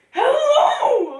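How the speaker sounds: voice crack. Retold or imitated. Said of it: "make their voice cracks".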